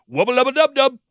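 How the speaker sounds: high squeaky cartoon shout; Rick voice. Rick and Morty catchphrase.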